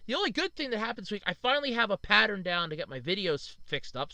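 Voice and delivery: ordinary talk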